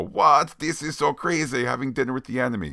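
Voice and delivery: thrilled to be dining with the enemy; giddy Yelena. Retold or imitated. Host imitating Yelena's giddy dinner delight, high and fast. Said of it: "Some of that is because of the role".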